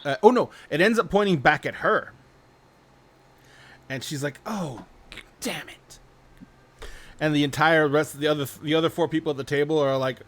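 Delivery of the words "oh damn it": hushed, almost whispered dismay; acted quiet exasperation; muttered curse. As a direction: curse softly under your breath. Loses the spin, curses to herself.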